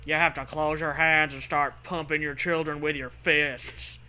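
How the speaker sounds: ordinary talk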